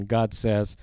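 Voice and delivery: normal talking voice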